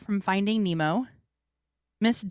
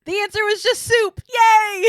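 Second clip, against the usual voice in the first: high loud cheer; excited; 9 dB louder than usual. Real, not acted. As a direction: shout it out in triumph. Cheering a correct answer.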